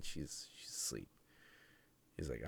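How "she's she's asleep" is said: hushed whisper so mom stays asleep; guarding the door, whispering so she won't wake. Retold or imitated. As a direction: breathe it without voice. Boy guarding sleeping mom.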